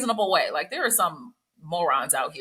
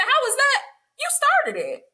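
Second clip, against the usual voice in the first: very high, incredulous pitch; high incredulous you started it; indignant rebuke; 12 semitones higher, slower than usual. Retold or imitated. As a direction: squeal it in disbelief. Retold indignant classroom rebuke.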